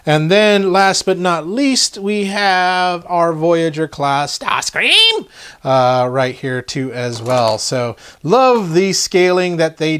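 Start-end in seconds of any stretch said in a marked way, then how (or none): 4.4-5.3 name stretched out, leaping up high; mock screech of a name. Toy's name screeched in character.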